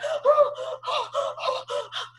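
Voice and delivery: high, repeated mock gasping; imitated panicky gasps. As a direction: imitate a mother-in-law gasping in alarm. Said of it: "She was like".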